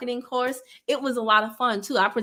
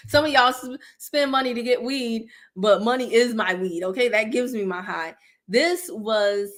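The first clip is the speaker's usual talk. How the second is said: ordinary delivery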